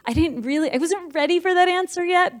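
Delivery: high-pitched inner voice; mock reluctant protest. Meditator reacting to sudden clarity, high mock protest. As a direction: quote a reluctant thought, pitched well up.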